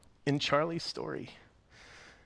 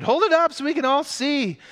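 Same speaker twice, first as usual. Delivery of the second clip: much higher, louder demand; crowd shout; 10 dB louder than usual. Acted. A bystander demands to see the ticket, shouting.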